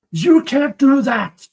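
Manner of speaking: indignant, drawn-out objection; outraged. Real, not acted. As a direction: drag it out in disbelief. Condemns the prosecution's move.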